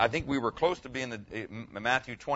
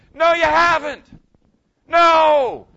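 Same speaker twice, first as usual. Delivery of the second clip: much higher, loud ringing cry of No; stones shouting back; 16 dB louder, much slower than usual. Pretend. Stones answer a despairing sinner with a loud, high shout. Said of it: "stones out there that are ready to say".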